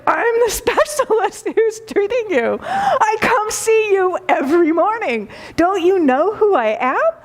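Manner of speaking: hammed-up wounded pride, voice climbing; indignant doctor. A doctor insisting to his patient that he matters, hammed-up indignant squeak. Doctor demands recognition again.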